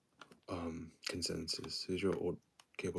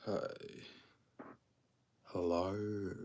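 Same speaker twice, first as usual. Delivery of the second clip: ordinary talk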